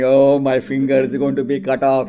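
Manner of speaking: loud, wailing little boy, voiced lightly; crying child. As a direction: whimper like a scared three-year-old. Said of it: "starts crying".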